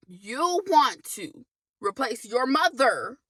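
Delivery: ordinary delivery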